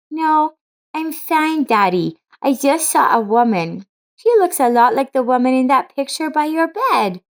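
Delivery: put-on high, eager child's voice; a small daughter telling her dad what she saw; little girl voice. Laura, young daughter, speaking.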